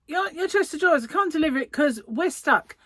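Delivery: put-on half-hearted go at a young London delivery driver accent. Retelling the delivery driver's call in a faint young London accent. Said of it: "I can't do the accent".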